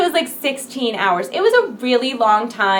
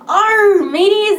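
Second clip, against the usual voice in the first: louder, higher playful pirate growl; 9 semitones higher, 5 dB louder, slower than usual. Pretend. Streamer playing pirate for viewers, louder mock pirate voice. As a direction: ham up a quick pirate call. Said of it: "be a freaking pirate".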